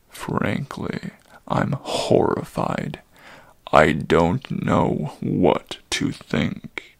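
low, slow, troubled centaur voice; an old creature barely holding his agitation; agitated old centaur. Acted. Old Chiron to the boy, low troubled voice.

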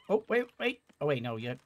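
ordinary talk, nothing marked

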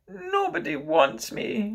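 put-on high, slow childish wail; nearly crying child. Michael feels unwanted by his siblings, nearly crying. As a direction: whine it slowly, close to tears. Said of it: "Michael had nearly cried".

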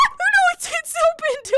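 very high, loud frightened cries; real scared squeals; panicked shriek. Real, not acted. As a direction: yelp in real terror. Terrified by horror game.

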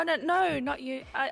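ordinary talk

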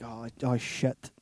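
low, slow, deflated retold reaction; mock-glum, drawn out and low; underplay the bad news, slowly; understated dismay. Retold reaction to wife dying.

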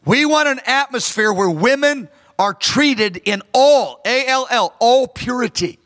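usual speaking voice

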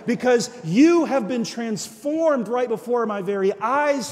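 loud, high declaration; loud proclamation. Retold or imitated. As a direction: proclaim it with force. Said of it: "And I said".

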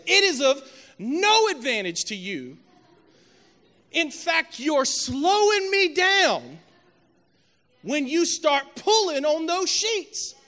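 loud, high, slow preacher's cry; full-force preaching. Acted. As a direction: stretch the rebuke out at a preacher's shout.

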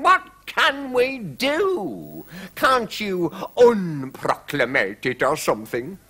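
high-pitched fretting monarch, drawing out the woe; distraught king. A narrator's reading. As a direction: play a flustered king in despair. The king in a dreadful state.

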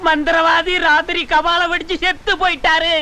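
high, put-on cry of lament; mock wailing. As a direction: wail a fake death announcement for the village. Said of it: "அப்படின்னு ஊருக்குள்ள கதை கட்டிக்க மாட்டேன்". (போலி ஒப்பாரி அழுகை)

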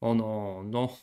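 normal talking voice

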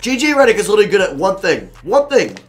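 loud, rising fed-up outburst; exasperated rant. Real, not acted. Gamer giving up on J J Redick, exasperated rant.

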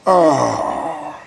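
a single broken sob of breath; a real cry escaping, fighting it down; choked cry. Real, not acted. Patient telling his viewers he's exhausted, a choked sob. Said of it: "And I'm holding back tears".

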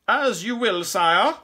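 high, loud courtier; curt courtly minstrel. A narrator's reading. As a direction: answer the king in ringing courtly tones. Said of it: "in a curt, courtly voice".

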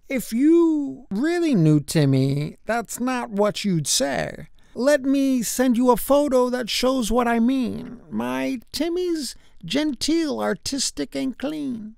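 lightly voiced polite father, softening at the end; a hint of a courtly, proud dad; courteous father. A narrator's reading. Father defending Timmy to officers, lightly courteous. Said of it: "in his courteous way".

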